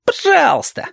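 put-on very high child voice; cheeky child. Reshka defying Alexander's threat, cheeky child voice. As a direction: toss it back high and cheeky.

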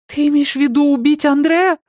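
high-pitched appalled cry; horrified outburst. A narrator's reading. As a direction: blurt it out in horror. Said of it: "вырвалось у Сильи".

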